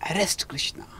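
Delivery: whispered plotting; soft secret whisper of a plotter; conspiratorial whisper. Acted. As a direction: breathe the scheme so only the king hears. Duryodhana reveals his secret plot.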